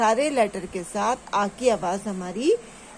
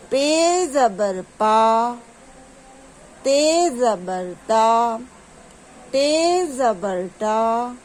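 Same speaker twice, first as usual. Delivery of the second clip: slow, even letter chant with level held endings; drawn-out repeat drill. Shown as a demonstration. Teacher asking pupils to repeat, drawn out. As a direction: lead pupils syllable by syllable, vowels stretched. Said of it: "ایک بار دوبارہ سے repeat کریں گے".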